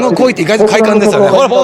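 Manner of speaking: excited glee. Real, not acted. Tossing fish, enjoying it.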